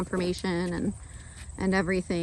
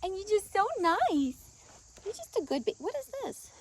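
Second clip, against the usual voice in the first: high sing-song voice to a pet; 11 semitones higher, 4 dB quieter than usual. Real, not acted. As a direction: coo at the horse. Baby talk to horse.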